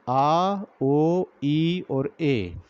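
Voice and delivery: "आ, ओ, ई और ए" each held very long; drawn-out vowel sounds. Shown as a demonstration. Teacher demonstrating vowel sounds, "आ, ओ, ई और ए" drawn out. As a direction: slowly stretch each vowel in "आ, ओ, ई और ए". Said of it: "इन आवाज़ों को".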